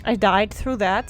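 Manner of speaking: normal talking voice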